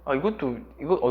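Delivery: normal talking voice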